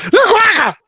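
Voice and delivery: very high squeaky mock; mocking imitation. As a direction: squeal it back at him. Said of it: "That's how you sound".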